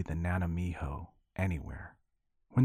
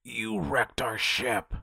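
put-on old sea hag voice; 4 semitones higher, slower than usual.

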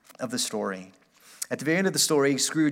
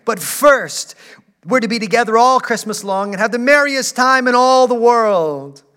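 high, loud, eager little sister voice; a small girl bursting with joy to fetch her brother; excited little girl; 7 semitones higher, 9 dB louder than usual. A narrator's reading. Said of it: "brimful of glee".

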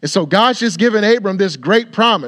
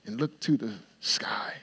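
whispered; 7 semitones lower, 13 dB quieter than usual. Acted. God voiced gently telling Abram.

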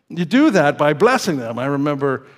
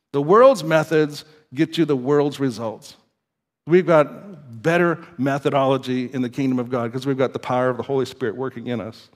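ordinary talk

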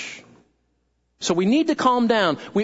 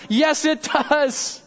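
"Yes, it does" higher and drawn out slowly; emphatic answer; 4 semitones higher, much slower than usual. Acted. Pastor answering doubts about social media, "Yes, it does" slow and emphatic. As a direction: stretch "does" as an obvious answer. Answering the listener's imagined question.

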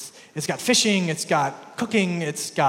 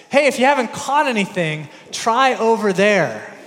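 calling out; 5 semitones higher, 7 dB louder than usual. Retold or imitated. Jesus calling to fishermen.

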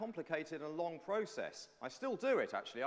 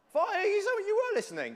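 much higher and louder, thrilled; delighted praise; 13 dB louder than usual. Real, not acted. Preacher thrilled that a child gave the answer, high and loud. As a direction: burst out in delight at the right answer.

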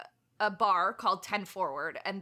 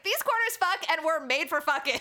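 excited; 7 semitones higher than usual. Retold or imitated. Excited retold reaction.

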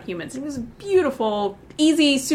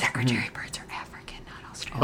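hushed aside, much softer; whispered correction; 12 semitones lower, 10 dB quieter than usual. Real, not acted. Correcting her partner about the bird, in a whisper.

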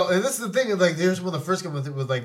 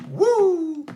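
excited whoop; 13 semitones higher, 4 dB louder than usual. Real, not acted.

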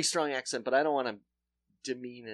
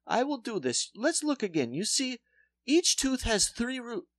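put-on higher, slow Polish accent, explaining; lecturing in mock Polish accent; 7 semitones higher, 4 dB louder than usual. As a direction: dentist explaining tooth roots in accent. Dentist voiced.

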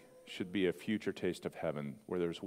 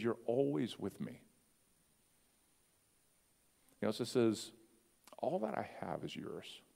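tender father; 5 dB quieter than usual. Retold or imitated.

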